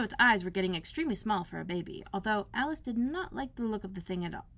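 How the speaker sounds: ordinary delivery, nothing marked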